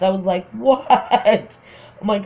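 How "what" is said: higher, acted mortified exclamation; embarrassed disbelief. Her reacting to being laughed at, embarrassed disbelief. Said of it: "I was embarrassed".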